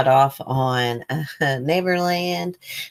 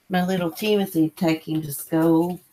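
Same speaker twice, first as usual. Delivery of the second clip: ordinary talk, nothing marked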